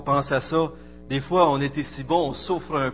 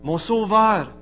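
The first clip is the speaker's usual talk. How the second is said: lecture recueillie; 3 semitones higher than usual. Acted. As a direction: read it like a litany over music, rising then fading. Recites a devotional text over music. (lecture solennelle et recueillie sur fond de musique)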